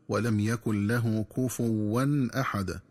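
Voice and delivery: slow verse with "كفوا" misread as "kufuwwan"; wrong doubled waw. Shown as a demonstration. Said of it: "ومن الخطأ كذلك قراءتها بتشديد الواو".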